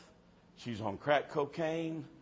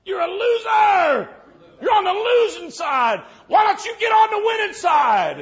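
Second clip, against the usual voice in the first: full-force preaching shout; 18 dB louder than usual. Retold or imitated. Preacher standing on the pew over the girl, re-enacted screaming sermon. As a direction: shout it right at one listener from the pew. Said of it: "preaching to her like that".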